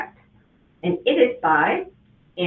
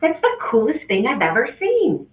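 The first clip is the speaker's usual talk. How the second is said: excited exclamation; 6 semitones higher than usual. Acted. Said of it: "his mother exclaimed".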